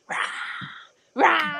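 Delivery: loud mock roar back; playful roar. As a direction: roar like a little monster. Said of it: "I'm always like".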